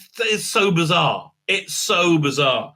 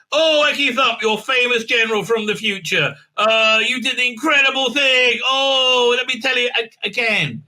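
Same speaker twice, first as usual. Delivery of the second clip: mock gushing praise; 6 semitones higher than usual. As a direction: gush over the hero in a mocking way. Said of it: "And he says".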